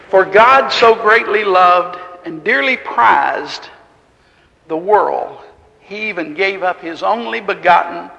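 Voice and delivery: measured, emphatic Bible reading; scripture reading. Acted. Preacher reading John three to the congregation, solemn.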